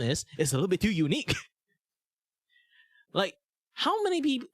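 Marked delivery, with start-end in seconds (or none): 3.1-4.5 high put-on voice. Shown as a demonstration. Mimics a put-on voice.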